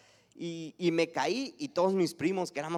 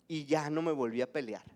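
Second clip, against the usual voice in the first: ordinary talk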